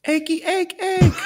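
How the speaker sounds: high, shouted rooster crow; Thai rooster call. Shown as a demonstration. Asked by his friend for a rooster call, crowing high and loud. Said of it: "Can you do a rooster imitation for me".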